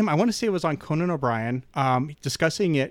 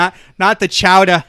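mock working-class Boston accent, louder; 4 semitones higher, 8 dB louder than usual. Contrasting it with patrician New England, broad Boston accent. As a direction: hit it with a broad Boston twang. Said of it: "that patrician accent of New England".

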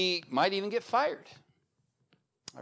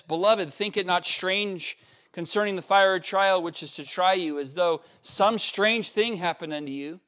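raised, solemn reading of the verses; scripture reading; 2 semitones higher, 4 dB louder than usual. Acted. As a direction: read it like a pulpit proclamation. Reads scripture passage aloud.

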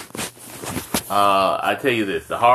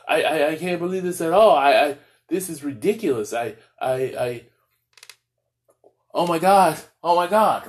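high-pitched mock distress, stammering in disbelief; mock crying; 6 semitones higher than usual. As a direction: act out a hammed-up, scandalized fuss. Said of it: "we're all scrambling and crying like".

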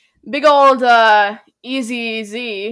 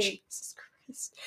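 whisper; 3 semitones lower, 18 dB quieter, much slower than usual. Real, not acted. Shocked reaction, then confiding.

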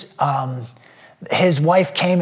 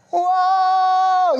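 very high, long amazed whoa; awed whoa. Retold or imitated. Pastor reacting to the girl's nickname, high amazed whoa. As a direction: gasp it out in wonder. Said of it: "And I was like".

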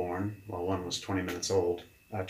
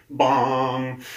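loud imitated gong hit; imitated gong sound; imitated bong; 4 semitones higher, 6 dB louder than usual. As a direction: make the sound of a bell struck. Sound of revelation hitting.

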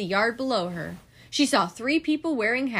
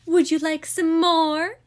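prim hostess Stella; 7 semitones higher, 5 dB louder than usual. A narrator's reading. Stella playing hostess at tea party.

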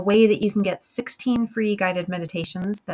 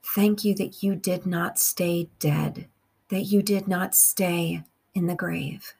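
slow, measured meditation with long pauses; guided prayer; slower than usual. Real, not acted. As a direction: pray phrase by phrase, unhurried, leaving room to repeat.

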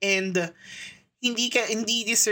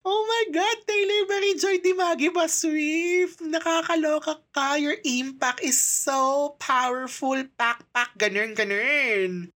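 excited fangirl squeal; 9 semitones higher than usual. Retold or imitated. Fan reacting to the new lyric video, high excited gushing. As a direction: gush over the singer like a thrilled fan. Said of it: "sabi ko".

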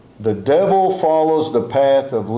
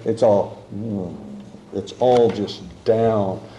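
mock gloom; 5 semitones lower, 3 dB quieter than usual. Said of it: "when it's sad".

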